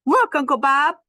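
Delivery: put-on eager little kid showing off; child calling. Ruby calling to Uncle Bob, eager child voice.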